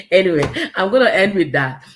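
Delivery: loud wrap-up through leftover laughter; laughing. Real, not acted. Speaker ending the livestream, laughing. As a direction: try to close while still laughing.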